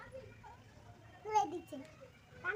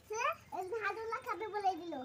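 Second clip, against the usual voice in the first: ordinary talk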